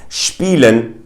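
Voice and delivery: SPIELEN as "SHPEE-len". Shown as a demonstration. Teacher gives an example word, s as sh. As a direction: open German SPIELEN with shp. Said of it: "pronounced as a sh".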